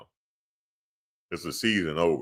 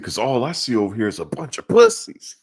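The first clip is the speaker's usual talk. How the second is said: coach taunt; 6 semitones higher, 5 dB louder than usual. Retold or imitated.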